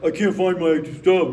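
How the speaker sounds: loud mock growly howl; howling bear. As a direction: imitate a husband's bad tone as a big bear. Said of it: "like a big howling bear".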